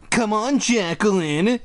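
high, slow whiny imitation; mocking whine. A narrator's reading. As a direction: mimic a little sister in a drawn-out whine. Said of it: "Jordan mocked in a whiny voice".

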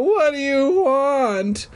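very high, hammed-up frightened wail; choked out. A narrator's reading. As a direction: plead in a squeezed, high voice. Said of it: "I managed to choke out".

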